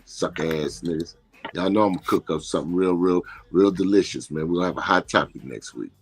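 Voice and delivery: normal talking voice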